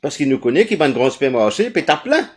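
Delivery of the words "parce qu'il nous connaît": usual speaking voice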